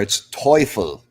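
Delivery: Teufel said slowly with no R, "TOY-fel"; German correction. Shown as a demonstration. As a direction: model Teufel slowly with the R dropped. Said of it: "You gotta drop the R in there".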